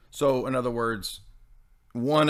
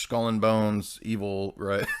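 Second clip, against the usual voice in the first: ordinary delivery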